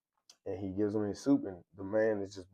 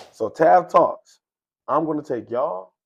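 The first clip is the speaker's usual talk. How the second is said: much louder, higher, slow announcer call; hyped sign-off; 6 semitones higher, 11 dB louder, slower than usual. Real, not acted. Host hypes his show name to viewers, loud slow call. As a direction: sell the show name big.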